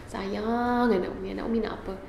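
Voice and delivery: put-on soothing a whining child; tender coaxing to child. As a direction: coax the child gently. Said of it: "saya peluk dia".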